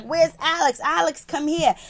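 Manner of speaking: imitated yelling. Angry shop boss calling Alex over, imitated mild yell. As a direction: call out as if yelling for someone. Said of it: "C's is yelling and screaming".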